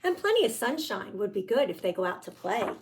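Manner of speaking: ordinary talk, nothing marked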